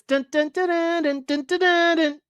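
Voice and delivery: sung dun dun trumpet imitation; trumpet riff. Host imitating the trumpets of the song by voice. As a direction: mimic the horn line with your voice. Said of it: "good trumpets".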